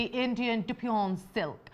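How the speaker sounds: slow, French-tinged fabric name; French-sounding. Real, not acted. Presenter naming the silk on air, accidentally French-sounding. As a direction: draw it out with a touch of French. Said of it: "I sounded French there".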